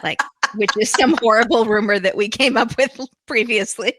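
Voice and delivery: words breaking up with laughter; laughing while talking. Real, not acted. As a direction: laugh through the sentence, amused at your own joke. Laughing over their own crude joke.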